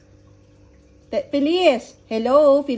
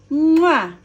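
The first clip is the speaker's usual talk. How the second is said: loud, high "mwah" kiss; blown kiss sound; 4 semitones higher, 5 dB louder than usual. Shown as a demonstration. Adult showing the child a flying kiss, blown kiss sound. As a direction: smack out a big flying kiss. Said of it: "flying kiss".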